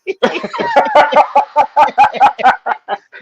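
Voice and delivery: long, high burst of laughter; big laugh. Real, not acted. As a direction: crack up helplessly at the joke. Cracking up at collect-call joke.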